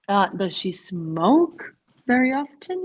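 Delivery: slow question, very stressed and rising; teacher modelling, leaning on very; slow model question. Shown as a demonstration. Teacher reads the example question slowly to the class. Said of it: "teacher models a question".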